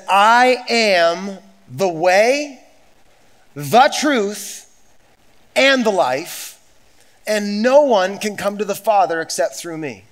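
slow, loud, drawn-out proclamation; booming pulpit quote; preaching at full force. Acted. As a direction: lean into every phrase like a declaration. Slow emphatic quoting of Jesus.

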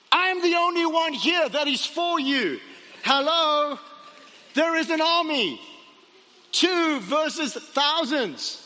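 hammed-up frantic disbelief, drawn out at the end; exasperated panic. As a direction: argue back as the alarmed servant. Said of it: "Imagine Gehazi in that moment".

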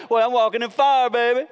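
put-on high, loud swaggering teen; hammed-up teen bravado; cocky teenager. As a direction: show off like a kid in the flames. Teenagers playing in the fire.

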